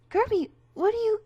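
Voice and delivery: high, loud alarmed question; shocked cry. Acted. Stabbed, shocked at Kirby.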